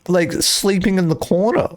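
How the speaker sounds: ordinary talk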